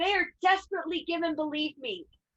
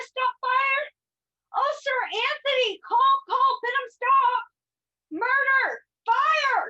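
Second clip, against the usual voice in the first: high shouted cries for help; raising the alarm; 7 semitones higher than usual. Acted. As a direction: yell for help down the street. Servant raising the alarm in street.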